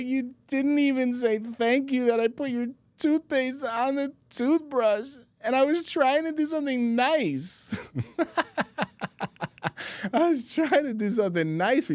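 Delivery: very high, mock tearful little-girl wail; imitated crying child. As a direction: whine it like a sobbing small daughter, hammed-up. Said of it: "like, crying".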